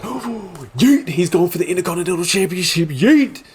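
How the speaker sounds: high, rapid hyped-up outburst; excited whooping. Retold or imitated. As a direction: race through it, whooping in delight. His excited reaction to the comeback.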